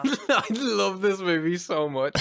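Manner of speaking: a real laugh running into the words. Real, not acted. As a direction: gush about it while still laughing. Laughing about loving the film.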